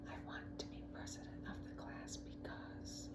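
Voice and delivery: soft, nervous near-whisper; almost a whisper. A narrator's reading. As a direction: barely breathe the words out, eyes closed. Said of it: "almost a whisper".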